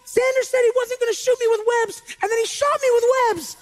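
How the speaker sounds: hammed-up tearful child complaint; Silas crying. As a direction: wail like a child hopelessly stuck. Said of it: "he was crying 'cause he was stuck in webs".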